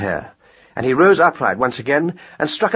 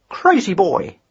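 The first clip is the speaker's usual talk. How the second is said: reflective; 8 semitones higher, slower than usual. Acted. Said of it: "it said reflectively".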